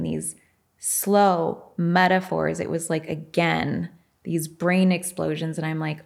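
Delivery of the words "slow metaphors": words drawn out at half speed; slow talker. Retold or imitated. Trainee describing her slow-talking teacher, drawn-out words. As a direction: stretch each word out slowly. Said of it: "he's kind of a slow talker".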